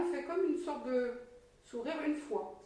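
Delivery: ordinary talk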